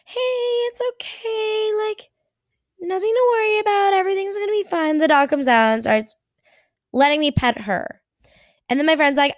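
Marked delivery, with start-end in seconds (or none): 0.1-5.0 soothing the dog. Retold or imitated. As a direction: coax a scared dog out gently. Coaxing a shy dog.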